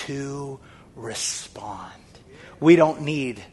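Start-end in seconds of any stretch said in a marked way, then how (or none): 0.1-2.0 slow unvoiced whisper. Preacher drops to a whisper on the point. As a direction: breathe the words out slowly.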